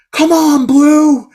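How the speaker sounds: high shouted heckle at the umpire; yelling. Retold or imitated. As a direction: yell it from behind home plate. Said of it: "I was yelling".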